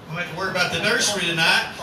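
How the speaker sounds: ordinary talk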